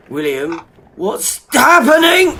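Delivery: high, loud, alarmed cry of fear; panicked shout. Acted. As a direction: yell for help while floating up, terrified. Suddenly floating in the air.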